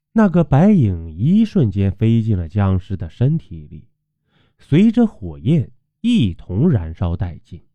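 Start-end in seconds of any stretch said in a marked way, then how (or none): none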